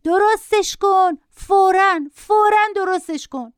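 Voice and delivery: put-on high-pitched, demanding little boy; shrieking boy. A narrator's reading. Boy ordering his mother to fix the tablet, shrieking. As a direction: play a screeching child without full volume.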